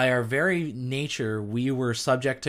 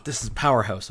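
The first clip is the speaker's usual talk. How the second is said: usual speaking voice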